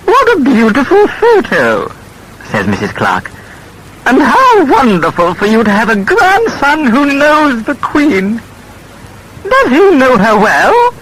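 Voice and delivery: put-on high, loud, effusive older lady; gushing neighbour. A narrator's reading. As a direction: gush over the photo to Gran. Neighbour voiced at tea.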